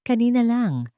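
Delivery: ordinary delivery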